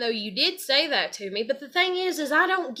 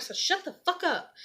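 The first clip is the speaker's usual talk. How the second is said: retold snap; 7 semitones higher than usual. Telling dad off.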